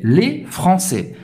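FRANÇAIS slowly, ending on an open è; silent final S. Shown as a demonstration. Teacher explaining the masculine, FRANÇAIS with silent S. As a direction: drop the last S of FRANÇAIS. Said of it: "você não vai pronunciar o S no final".

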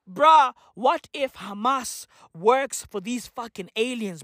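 normal talking voice

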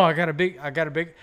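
ordinary delivery

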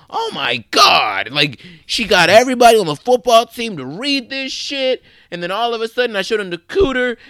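high, loud, hammed-up gushing praise; gushing over-the-top imitation. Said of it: "you're laying it on really thick".

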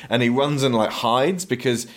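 normal talking voice

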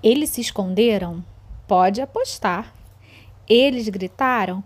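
slow, suspenseful storytelling; reading to children. A narrator's reading. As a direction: draw the kids into a spooky tale. Teacher reads story to children.

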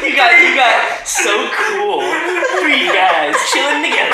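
cracking up while repeating it; laughing. Real, not acted. Mocking the silly name.

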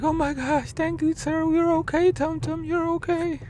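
high squeaky relieved thanks; friends' voice. Retold or imitated. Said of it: "They're like".

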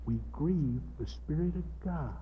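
ordinary talk, nothing marked

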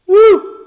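short, loud high-pitched whoop; a high festive "woo". Pretend. Host cheers his own festive special, high loud whoop.

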